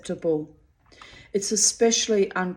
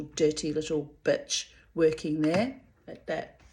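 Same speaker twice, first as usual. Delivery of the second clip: normal talking voice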